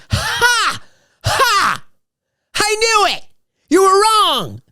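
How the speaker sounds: very high, crowing mock triumph; high-pitched gloat, each burst separate; imitating gloating fiancee. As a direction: crow your victory in a shrieky high put-on voice. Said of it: "like that".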